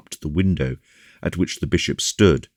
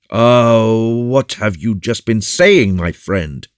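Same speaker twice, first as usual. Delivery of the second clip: loud, high exclamation of dismay; big-voiced, shocked protest; astonished; 8 semitones higher, 8 dB louder, slower than usual. A narrator's reading. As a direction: burst out in disbelief. Porthos astonished at his friend's speech.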